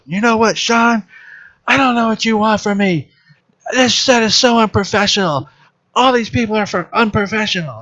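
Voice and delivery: imitating a shrill-pitched blowup, high and indignant; mock outburst. Actress blowing up at Sean on set, mock furious and high. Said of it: "So then she blows up".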